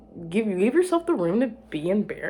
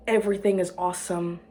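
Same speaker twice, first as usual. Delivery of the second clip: ordinary delivery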